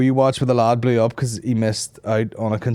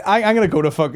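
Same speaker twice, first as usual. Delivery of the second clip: normal talking voice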